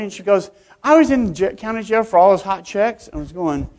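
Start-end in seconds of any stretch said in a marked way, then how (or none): 0.8-3.1 clerk's voice. Retold or imitated. Clerk cheerfully admits jail time.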